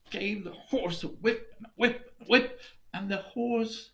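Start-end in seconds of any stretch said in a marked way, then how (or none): none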